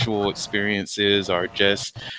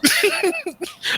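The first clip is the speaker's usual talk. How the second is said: shrieking burst of laughter; high squealing laugh. Real, not acted. Nearly spat out drink.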